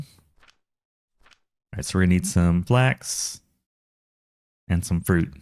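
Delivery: usual speaking voice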